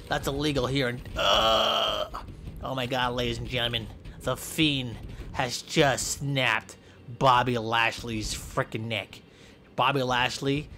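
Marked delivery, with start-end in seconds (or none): none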